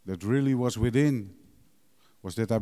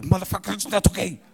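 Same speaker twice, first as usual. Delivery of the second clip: higher, louder mock outburst; inner angry retort; 9 semitones higher, 7 dB louder, faster than usual. Answering the calm guy at the meeting, angry. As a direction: snap it back in your head, higher and louder. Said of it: "And I thought".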